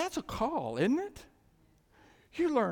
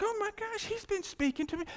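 high-pitched retold astonishment; amazed realization; 11 semitones higher than usual. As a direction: relive the discovery in a high voice. Replaying the moment of realization.

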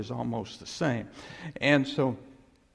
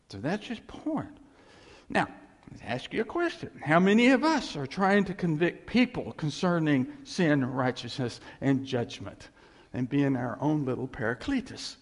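ordinary delivery

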